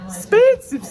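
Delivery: high squeaky exclamation; mock shock. Real, not acted.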